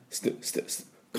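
スティ as a short unvoiced "st"; soft, quick "st" bursts for スティ; vowelless ST. Shown as a demonstration. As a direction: hiss out スティ with no voice. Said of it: "エスティーのところですね".

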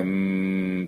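うーん as a sustained "mmm"; うーん held like pondering; long M sound. Shown as a demonstration. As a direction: hum うーん through closed lips. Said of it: "これはMの音です".

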